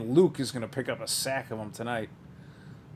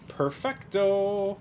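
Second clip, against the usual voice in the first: drawn out; 9 semitones higher, much slower than usual. Real, not acted. Savoring card name.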